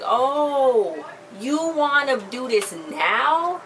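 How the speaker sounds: slow, taunting mock challenge; you wanna do this now, said slow and daring. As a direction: imitate a friend squaring up, drawn out. Friends' fight retold, challenging quote.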